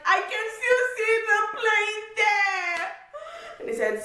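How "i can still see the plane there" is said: high, slow, pleading cry; begging to be let on the plane; much higher, drawn-out plea; desperate protest. Retold or imitated. Desperate protest to gate agent.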